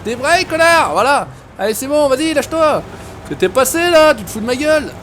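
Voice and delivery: high-pitched, frustrated outburst; exasperated yelling. Real, not acted. Yelling at the stuck truck.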